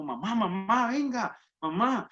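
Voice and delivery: put-on child calling mom, pitch climbing; high, insistent "come here" call. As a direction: imitate a kid summoning mom, drawn out. Retold child calling her mother.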